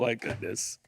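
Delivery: ordinary delivery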